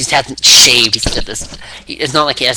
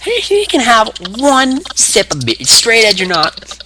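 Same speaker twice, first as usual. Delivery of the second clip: slow, high-pitched put-on wrestler voice; mock insistence; 12 semitones higher, 4 dB louder, slower than usual. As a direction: drag out the concession in a raised voice. Acting Austin insisting on one sip.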